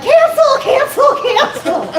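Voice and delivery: high, frantic calls to undo the curse; snatch the wish back in a high panic; panicked take-back. Retold or imitated. Comic telling her wish to burn his house to stop, high and frantic. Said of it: "I'm like".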